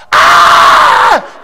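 sharp high yelp of fright; startled yelp. Retold or imitated. Boy yelping as his dad touches his belt. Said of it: "and I went".

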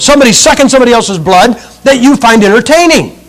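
loud, driving pulpit delivery; fiery preaching. Acted. Preacher warning his flock about vampire books, fiery. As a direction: hammer it home to the congregation.

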